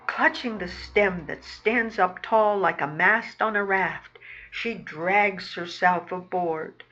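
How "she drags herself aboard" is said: ordinary delivery, nothing marked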